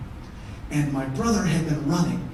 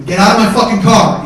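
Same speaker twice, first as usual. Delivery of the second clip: loud, forceful command; angry order; 2 semitones higher, 10 dB louder than usual. Retold or imitated. Mike looking down at his brother, loudly ordering him out. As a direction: yell the demand at him.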